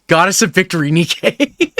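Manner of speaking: laughing, high and loud; laughing excitedly. Real, not acted. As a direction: burst out laughing at the result.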